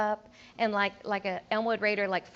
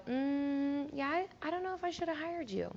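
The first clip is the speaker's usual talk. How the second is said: soft, higher, hesitant principal; doubtful principal; 5 semitones higher, 8 dB quieter than usual. Retold or imitated. Principal answering a vague teacher, soft doubtful mock voice. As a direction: sound unconvinced about the hire. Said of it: "they're gonna go".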